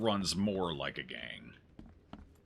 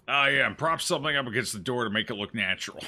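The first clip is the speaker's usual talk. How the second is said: louder, hurried mock henchman; mock gang member; 4 semitones higher, 6 dB louder, faster than usual. Player imagining the gang hiding the hole, mock hurried voice. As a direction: rattle it off as a hammed-up flustered crook. Acting out the gang's hasty fix.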